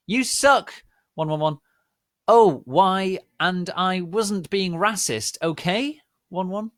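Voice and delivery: high, loud ranting author; furious shouted author's note; angry rant. Acted. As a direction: yell at the critics.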